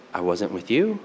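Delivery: low, soft imitation of a pensive child; quiet, self-reflective. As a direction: voice a hushed little question. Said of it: "she was really quiet and self-reflective".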